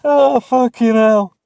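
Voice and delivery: high, loud, slow wheezing laugh through the words; crying with laughter. Real, not acted. Host in hysterics at a chat joke, laughing till snotting. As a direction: laugh till you can barely get words out. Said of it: "I'm snotting".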